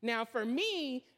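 ordinary talk, nothing marked

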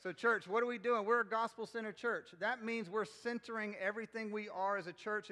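high, loud preaching voice. Acted. Pastor preaches to the congregation in full voice. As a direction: preach it out to the room.

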